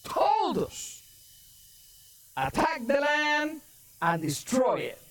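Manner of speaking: high-pitched, driving sermon delivery; forceful preaching. Acted. Preacher retells the enemy's threat to God's people, forceful preaching. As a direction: preach it high with drawn-out key words.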